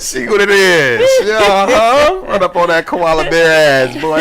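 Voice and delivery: goof around cracking up; laughing mock bit.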